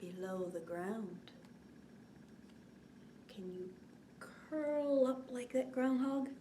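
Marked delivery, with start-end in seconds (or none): none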